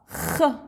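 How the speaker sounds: soft kh, throat rasp with no vowel; teacher isolating the kh sound; bare kh sound. Shown as a demonstration. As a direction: breathe out kh from the throat. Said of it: "teacher isolates letter sound".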